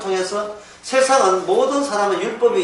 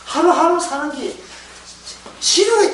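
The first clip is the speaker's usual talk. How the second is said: 지루해 in a higher, whiny bored voice; bored retiree; 9 semitones higher than usual. Retold or imitated. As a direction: groan it like a bored retired man. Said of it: "특히 이제 은퇴하시고 난 뒤에".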